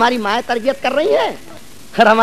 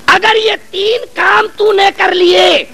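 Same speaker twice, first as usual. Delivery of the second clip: emphatic preaching; 8 semitones higher than usual. Real, not acted. Preacher rises to emphatic promise.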